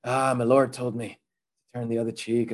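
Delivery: low-pitched attempt at an Irish accent; meek fighter in badly faked Irish. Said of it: "that's my Irish accent".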